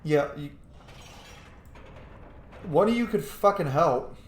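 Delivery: ordinary talk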